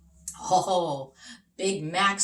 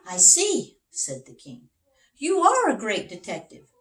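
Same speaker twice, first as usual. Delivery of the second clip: higher and louder, full of praise; the king impressed by his friend; admiring king; 4 semitones higher, 8 dB louder than usual. Acted. The king praises Big Max, higher and louder.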